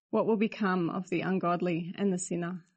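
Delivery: usual speaking voice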